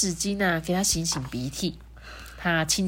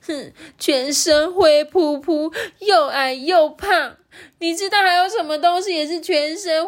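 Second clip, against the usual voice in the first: high, slow, tearful wail; crying; 9 semitones higher, 4 dB louder than usual. Acted. Rourou cries after being called grey and fat, wailing. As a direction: sob the insult back, stretching the words. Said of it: "柔柔就哭了啊".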